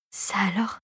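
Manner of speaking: a stifled, breathless whisper of shock; the old inventor gasps under his breath; choked whisper. A narrator's reading. The inventor, stunned by the strange object, whispers in shock. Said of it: "S'étouffa le maître des lieux".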